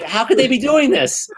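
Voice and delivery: mock horror at the movie; retold disbelief. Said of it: "I'm like".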